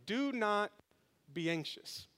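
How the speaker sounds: soft, slow verse reading; scripture reading. Acted. Pastor reads the key verse softly to the church. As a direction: read the verse gently to the congregation.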